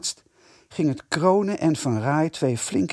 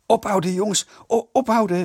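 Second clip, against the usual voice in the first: high, loud stammering shout; urgent shout; 7 semitones higher, 4 dB louder than usual. A narrator's reading. Eddy yells to stop throwing at the headmaster, urgent. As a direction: yell at them to stop, alarmed. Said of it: "riep Eddy".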